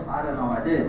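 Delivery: ordinary delivery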